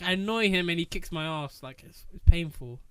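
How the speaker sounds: normal talking voice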